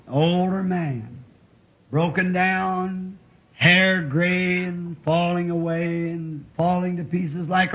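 slow, drawn-out tender pity; slow and tender, spoken to the old. Acted. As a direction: linger on each word with pity. Tender address to aged listeners.

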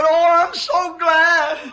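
overjoyed. Retold or imitated. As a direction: let gladness overflow slowly. Overjoyed reply in the vision.

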